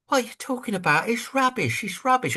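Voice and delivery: high, dismissive scoff; mock scoffing crowd. Doubters dismissing a contrarian's tip, high mock scoff. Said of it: "they go".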